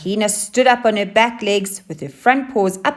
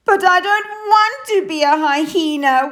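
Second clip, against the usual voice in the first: high, louder wailing cry; wailed; 12 semitones higher, 5 dB louder than usual. Acted. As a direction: wail it out in despair. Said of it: "wailed Hyena".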